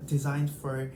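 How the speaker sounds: ordinary talk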